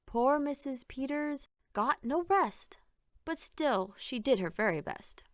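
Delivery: usual speaking voice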